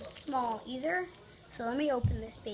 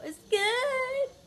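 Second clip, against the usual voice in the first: much higher, squeaky strained voice; strained squeak; 12 semitones higher, 4 dB louder than usual. Real, not acted. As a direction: squeak it out, puckered. Tasting the candy.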